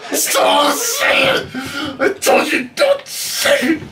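high, slow, whining plea; whiny pleading. Acted. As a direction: beg him to stop, high and whiny. Pleading friend to stop saying.

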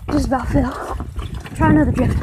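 normal talking voice

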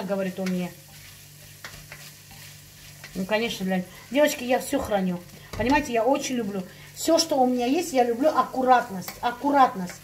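ordinary talk, nothing marked

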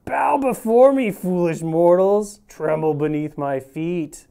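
slow booming villain proclamation; dark lord. Retold or imitated. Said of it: "he was like".

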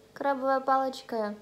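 speaking between bites of sushi; chewing food while talking. Real, not acted. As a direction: mouth partly full of food. Seen 1: Bites roll at -1.0, lips pursed and chewing early, then mouth looks clearer.

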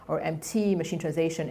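ordinary talk

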